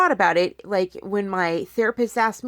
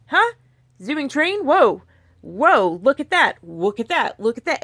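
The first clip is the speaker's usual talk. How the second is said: mock wonder, higher and louder on the whoa; amazed brain voice; 5 semitones higher, 4 dB louder than usual. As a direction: play your brain suddenly distracted by a train. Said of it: "Your brain's gonna be like".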